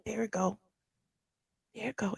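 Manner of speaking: hushed, watching closely. Real, not acted. Hushed while watching the video to viewers. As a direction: murmur it under your breath. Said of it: "I don't know why I'm whispering".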